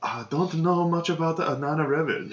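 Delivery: high, soft, unsure mock voice; timid foreign voice. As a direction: mildly imitate a confused captive. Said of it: "he's like".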